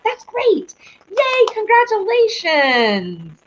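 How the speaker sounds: high cheer, slowing on the last word; whoop, then stretch out the congratulations; excited congratulations. Real, not acted. Host congratulating viewer on new job, excited.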